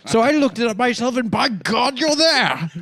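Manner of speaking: animated British-accented dad impression.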